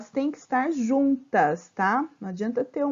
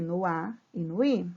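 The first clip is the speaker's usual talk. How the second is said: ordinary delivery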